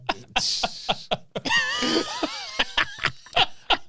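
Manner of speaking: shrieking laugh. Real, not acted. Mate's hot water joke sets him off, shrieking laugh. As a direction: burst into a long shrieking laugh.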